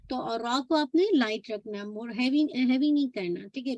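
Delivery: ordinary talk, nothing marked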